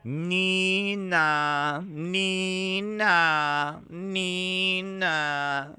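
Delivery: sung nee-na siren wail; siren imitation. Impression of a French ambulance, siren nee-na. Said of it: "That is my impression of a French ambulance".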